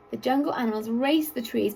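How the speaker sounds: ordinary delivery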